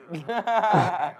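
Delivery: high, rapid laugh; bursting out laughing. Real, not acted. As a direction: crack up after the prank. Laughs after revealing the prank.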